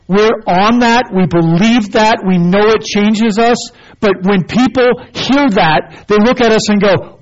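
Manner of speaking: preacher rising to full force; full-force preaching. Acted. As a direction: drive the point home from the pulpit.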